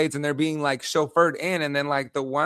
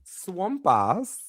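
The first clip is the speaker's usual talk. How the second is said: Aussie accent; 5 semitones higher than usual. Shown as a demonstration. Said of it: "Say it. Go ahead. You live there".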